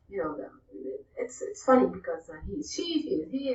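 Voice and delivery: usual speaking voice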